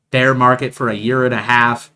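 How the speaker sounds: ordinary talk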